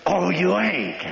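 high-pitched sergeant's challenge; imitated sergeant. Sergeant to a soldier refusing the hike, mock challenge. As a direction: mimic a sergeant challenging a recruit. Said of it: "Sergeant, I'm not going on the hike".

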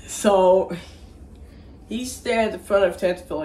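normal talking voice

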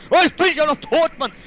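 loud, high angry cry; frustrated gamer outburst; exasperated yell. Real, not acted. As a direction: explode in frustration at dying. Dies in game, raging.

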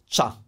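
CHA as a single syllable; one sound. Shown as a demonstration. Teacher showing learners C I A as one-syllable CHA. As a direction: say "cha" in one beat, no i heard. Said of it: "C I A is one sound".

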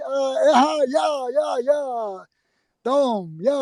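high, shouted panicked gasps; flailing in the water, crying out. Retold or imitated. Thrown into the water. (suya atılınca panikle bağırarak)

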